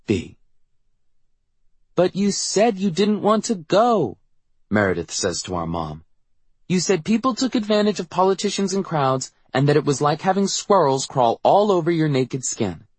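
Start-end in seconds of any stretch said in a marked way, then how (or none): 1.9-4.2 put-on high, drawn-out little-girl protest; high-pitched child's complaint. A narrator's reading. 6.7-12.9 put-on high little-girl voice; high-voiced child objecting to mom; precocious child protesting. A narrator's reading. Voices young Meredith protesting.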